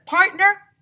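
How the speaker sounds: high, loud cowboy call; teacher calling his partner, high and loud. A narrator's reading. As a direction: holler across the dig to a partner. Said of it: "calling each other".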